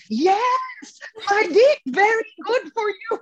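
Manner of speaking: put-on Ketut's cheerful Balinese accent, pitched high; imitating Ketut. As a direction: agree happily like the beaming old priest. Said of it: "And Ketut goes".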